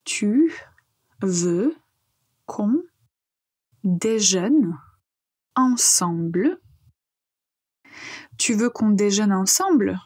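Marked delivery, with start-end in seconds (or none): 0.0-6.6 one word at a time with long pauses between; slow pronunciation drill, each word set apart. Shown as a demonstration. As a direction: say each word separately for learners to repeat. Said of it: "on apprend à prononcer".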